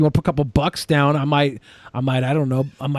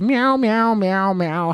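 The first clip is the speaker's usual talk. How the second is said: cat meows; 5 semitones higher, slower than usual. Retold or imitated. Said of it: "My cat Cosette says".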